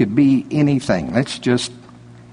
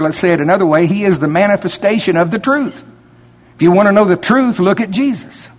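preaching at full force; 6 semitones higher than usual. Acted. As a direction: drive it home like a revival preacher.

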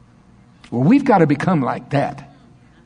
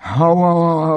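mock yawn, bored; 3 semitones higher, slower than usual. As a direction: fake a big lazy yawn. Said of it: "I'm yawning is what I'm doing here".

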